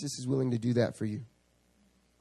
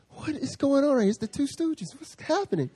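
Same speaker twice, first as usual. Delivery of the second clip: much higher incredulous voice; bewildered disbelief; 13 semitones higher than usual. Retold or imitated. Pastor baffled by his small group, high incredulous. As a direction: throw up your hands in disbelief. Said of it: "I was like".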